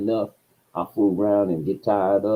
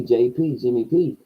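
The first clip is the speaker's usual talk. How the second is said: ordinary talk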